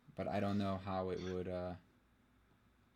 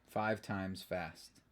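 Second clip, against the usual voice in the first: slow and softer, words stretched; deliberately slow; 2 semitones lower, 6 dB quieter, much slower than usual. Pretend. Answering a tongue-twister challenge, deliberately slow. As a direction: draw it out at half speed.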